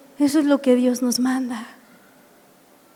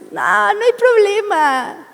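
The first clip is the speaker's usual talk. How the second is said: higher, slow, lightly voiced churchwoman; sister Alma's tone; 6 semitones higher, slower than usual. Pastor imitating Alma shrugging off the assault, higher and slow. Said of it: "Así en su tono de voz".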